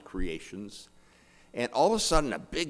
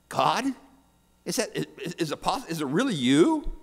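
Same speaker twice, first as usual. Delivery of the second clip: high, louder, astonished questioning; stammering disbelief; 7 semitones higher, 4 dB louder than usual. Acted. Atheist hearing God answer, stammering disbelief. Awed atheist addresses heavenly voice.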